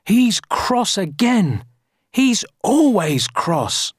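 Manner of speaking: high, slow villagers' lament; dismayed complaint. A narrator's reading. Villagers complaining about the cross giant, dismayed.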